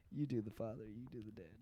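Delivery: softly put-on TV host; Doctor Phil. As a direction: turn to the next guest in a hushed voice. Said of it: "and then he goes".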